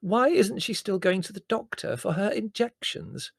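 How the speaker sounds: high, insistent plaintive question. A narrator's reading. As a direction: ask it as a helpless complaint. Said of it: "said Mother plaintively".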